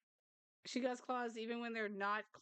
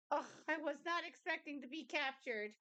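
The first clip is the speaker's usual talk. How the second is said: lightly voiced cat, pitched higher; voicing the cat; 6 semitones higher than usual. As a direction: speak for the startled cat in a higher voice. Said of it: "you were like".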